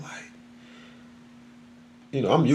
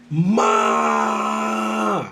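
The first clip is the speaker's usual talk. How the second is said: high, loud call for mom; yelling; 10 semitones higher, 6 dB louder than usual. Retold or imitated. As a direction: shout for Ma across the house. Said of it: "as I'm yelling".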